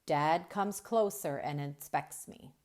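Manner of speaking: usual speaking voice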